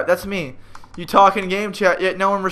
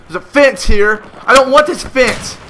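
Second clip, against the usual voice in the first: loud, raised-pitch griping; irritated outburst; 6 semitones higher, 6 dB louder than usual. Real, not acted. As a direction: complain loudly at the obstacle in your way. Blocked by a fence, fuming.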